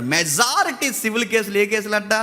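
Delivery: ordinary talk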